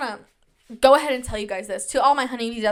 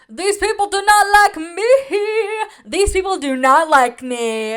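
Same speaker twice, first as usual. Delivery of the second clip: mock despair; 6 semitones higher, 7 dB louder than usual. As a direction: wail it loudly with hammed-up misery. Mock despair at being disliked.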